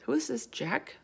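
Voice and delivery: soft, hushed question; small, secretive voice; whisper. Acted. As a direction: ask it under your breath. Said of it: "Sophie whispered".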